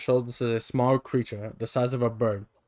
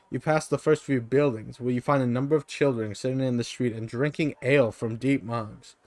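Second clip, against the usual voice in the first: ordinary talk, nothing marked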